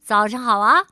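bright friendly Mr. Happy voice; lightly voiced cheerful character; cheerful greeting. A narrator's reading. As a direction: call out a happy good morning. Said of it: "快乐先生跟他打招呼".

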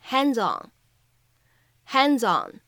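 "hands on" joined as "han-zon"; linked hands on. Shown as a demonstration. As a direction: slide "hands" into "on". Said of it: "我们可以读成".

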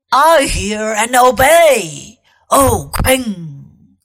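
loud growled wolf voice; growling wolf. Acted. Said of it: "growled the wolf".

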